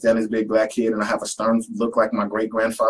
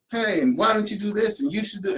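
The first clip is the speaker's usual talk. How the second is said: higher, bossy nagging; lightly voiced teacher picking on him; 11 semitones higher than usual.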